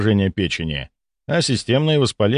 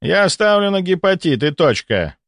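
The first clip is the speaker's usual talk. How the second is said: higher-pitched, categorical verdict; curt, final; 9 semitones higher than usual. A narrator's reading. Volkonsky closes the dispute with colleague, curtly final. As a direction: cut off all argument, pitch raised. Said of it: "отрезал Волконский".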